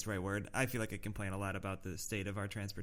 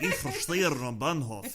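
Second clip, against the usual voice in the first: slow, exaggerated Russian-accented German; mock Russian accent; 3 semitones higher, 4 dB louder, slower than usual. Host mimics co-host's slip, mock Russian accent. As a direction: tease with a thick Russian accent, half laughing. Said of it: "like, a nice little Russian accent".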